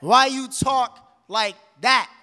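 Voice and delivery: loud question, broken up by pauses; emphatic challenge. Acted. Poet pressing someone on their talk, loud and emphatic. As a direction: punch it out in separate loud pieces.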